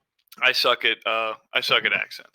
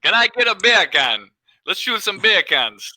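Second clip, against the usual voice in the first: higher, louder mock Jamaican accent; Jamaican-sounding accent; 8 semitones higher, 3 dB louder than usual. Shown as a demonstration. Trying the beer can joke on friends, mock Jamaican accent. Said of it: "without sounding like a Jamaican asking for bacon".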